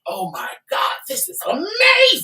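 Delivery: hammed-up high squeal of delight; mock loopy delight. Wife mimicking her drugged husband's sandwich joy, high squeal.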